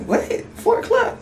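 high incredulous rise; surprised squeak. Retold or imitated. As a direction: shoot up in disbelief. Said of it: "I was just like".

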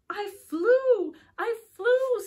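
high, loud, thrilled cry; excited shout. A narrator's reading. Little bird shouting to Mama that she flew. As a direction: shout it with pure joy. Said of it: "said Mel".

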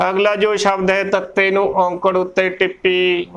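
ordinary talk

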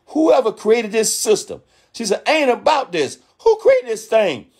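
high-pitched ranting outburst, pitch shooting up; mad screaming. Retold or imitated. Retelling a young woman's rant about working forty years, high mock yell. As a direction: retell her going off, mock outrage. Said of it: "She was screaming".

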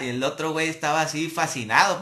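ordinary talk

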